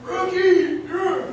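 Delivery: mock arena roar; crowd cheer. Imitating the Rocky crowd cheer.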